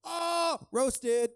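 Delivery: high, loud gleeful tease; teasing roast. Real, not acted. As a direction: crow it like a friend got roasted.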